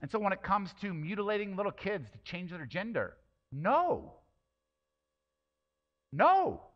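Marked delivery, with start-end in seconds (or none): 3.5-6.7 emphatic no. Real, not acted. Preacher rejects child gender surgery.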